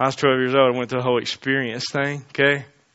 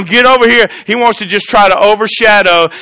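loud, high preaching voice; fervent sermon delivery; 7 semitones higher, 10 dB louder than usual. Acted. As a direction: preach it out to the congregation. Preaching at full force.

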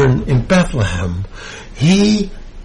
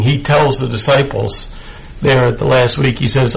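usual speaking voice

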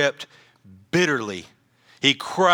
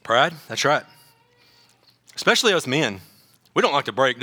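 ordinary talk, nothing marked

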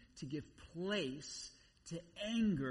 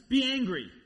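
much louder, high burst; sudden shouted command; 8 semitones higher, 14 dB louder than usual. Acted. Preacher showing how the verse jumps out, sudden shout. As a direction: blurt it as a jarring shout.

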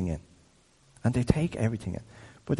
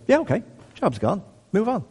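breezy and much higher, no big deal; casual higher-pitched shrug; shrugging it off; 10 semitones higher than usual. Retold or imitated. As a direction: toss it away like it's nothing. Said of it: "other people are like".

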